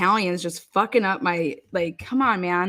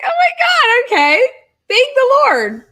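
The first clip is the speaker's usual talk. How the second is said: much higher, louder gushing relief; mock relief; 9 dB louder than usual. To the friend who says she's not interested, high relieved gush. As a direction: ham up huge relief.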